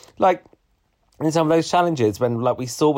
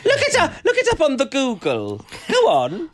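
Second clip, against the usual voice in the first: laughing, much higher mock old-lady voice; mock granny talk; 11 semitones higher than usual. Teasing co-host as a granny, laughing high old-lady voice. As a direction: tease like talking to a granny. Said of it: "all right, Granny".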